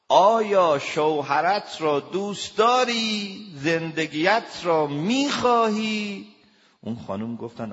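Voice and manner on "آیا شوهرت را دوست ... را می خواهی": much higher, much louder and slow, each word hammered; stern shout. Retold or imitated. Counsellor shouting his question at the woman on the phone. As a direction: shout the question slowly to force a straight answer. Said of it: "شما داد نزن.»".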